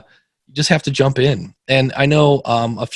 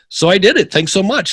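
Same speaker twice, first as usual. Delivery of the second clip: higher and louder, bubbling over; excited thanks; 9 semitones higher, 4 dB louder than usual. Retold or imitated. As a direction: voice a thrilled listener saying thanks. Listener thanking him, voiced excitedly.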